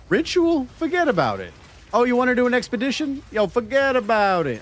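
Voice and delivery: put-on New York accent. Streamer mocking league content in a hammed-up New York accent. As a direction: brush it off like a wiseguy from New York. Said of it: "brought the New Yorker out of this".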